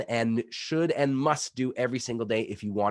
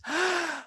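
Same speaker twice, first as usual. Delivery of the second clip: sharp high gasp for big face; big wide-eyed gasp. Shown as a demonstration. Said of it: "Big face is like this".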